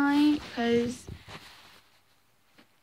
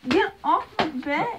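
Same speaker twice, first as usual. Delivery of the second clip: ordinary delivery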